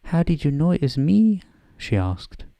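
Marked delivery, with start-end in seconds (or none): 0.0-1.4 put-on higher, louder Scottish accent for a stern professor; a slightly overdone Scottish accent, briskly; Scottish McGonagall. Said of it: "almost bad Scottish accent".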